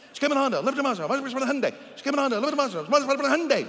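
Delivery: loud, high, rapid-fire chant; sped up. Shown as a demonstration. Preacher parodying taught tongues, sped-up loud rattle. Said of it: "Come on, speed it up".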